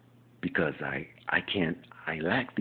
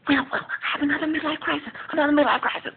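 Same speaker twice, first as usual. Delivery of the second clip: higher, louder flamboyant sitcom voice; Jack impression; 3 dB louder, faster than usual. Jack telling Will about his crisis, high and loud. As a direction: burst in with drama. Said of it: "impersonation of ... Just Jack".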